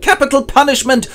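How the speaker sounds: high, loud hammed-up pronouncement of doom; mock judges' verdict. Judges sentencing the sick prisoner, hammed-up high loud verdict. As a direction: squeal the sentence like a cartoon judge. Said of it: "they mumble something like".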